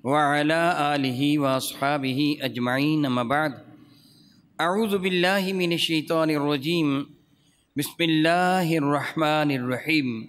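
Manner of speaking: measured low opening prayer; formal invocation. Acted. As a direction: open the lesson solemnly. (سنجیدہ دعائیہ آغاز)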